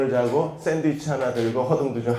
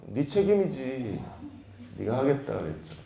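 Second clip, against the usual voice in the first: calm reply; 8 dB quieter than usual. Retold or imitated. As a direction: stay cool and quiet. Parent calmly replies.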